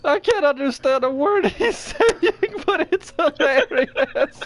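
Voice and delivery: high voice breaking into laughter; laughing. Real, not acted. Host speaking to chat while cracking up, high and laughing. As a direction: talk through the giggles, very high.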